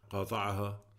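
ordinary delivery

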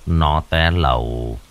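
put-on slow, low, weary old man; heavy sigh. Grandfather telling how his grandson died, slow and heavy. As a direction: let the words sink out slowly. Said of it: "Ông ba thở dài".

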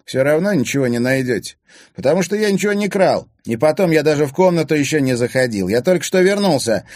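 loud, high self-defence; heated protest. A narrator's reading. Accused boy defending himself before the search, indignant. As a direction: protest your innocence heatedly.

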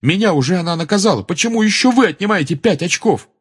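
high, loud, hurried complaint; outraged. A narrator's reading. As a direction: fire back at the injustice. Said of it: "Гарри был взбешён этой несправедливостью". (возмущённо, громко, с напором)

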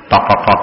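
low, soft "tok, tok, tok"; polite knocking. Shown as a demonstration. Teacher demonstrating a knock with a polite sound, soft knocking. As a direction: imitate a courteous, gentle knock.

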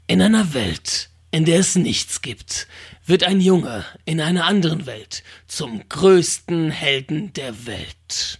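slow, low, breathy trailer voice; movie trailer voice. Shown as a demonstration. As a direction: lean in and breathe it like a cinema voiceover. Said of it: "die Stimme geht ein bisschen runter und sagt dann".

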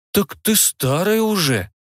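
slow, stretched out, let down; disappointed drawl. A narrator's reading. Boy tells the older frog-girl she is old, slow and disappointed. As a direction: draw it out in mild disappointment. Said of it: "протянул парень разочарованно".

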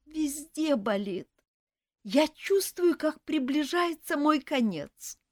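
high, slow self-pitying wail; whiny dying elf. A narrator's reading. Elf insists to nurse he is dying, wailing. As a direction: wail as if on your deathbed. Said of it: "всхлипывая".

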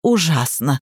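plain reading voice

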